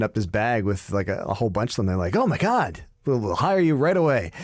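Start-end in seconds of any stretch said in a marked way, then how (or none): 2.2-4.3 high, thrilled gasp of the shop managers, pitch jumping up; mock amazed. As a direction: act bowled over, voice shooting up high. Staff react to bag of CVs.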